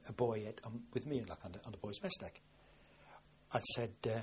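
normal talking voice

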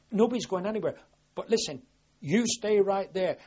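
commanding shout to a wounded man; shouted order. Retold or imitated. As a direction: call out over the noise of the attack. Shouting orders during the attack.

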